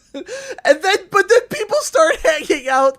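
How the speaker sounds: ordinary delivery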